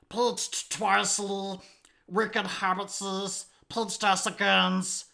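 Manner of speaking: whiny creature with a politician's cadence; high, loud, drawn-out whine in slow punchy phrases; hammed-up mash-up impression, building to a loud last word; Gollum J Trump. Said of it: "cadence of the Trump and the, the whininess of the Gollum".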